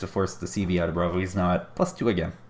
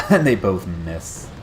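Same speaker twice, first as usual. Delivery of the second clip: laughing; 6 dB louder, much slower than usual. Real, not acted. Caster laughs at double miss.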